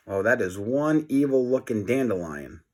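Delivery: ordinary delivery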